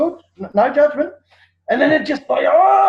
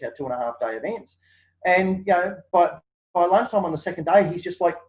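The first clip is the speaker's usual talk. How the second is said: normal talking voice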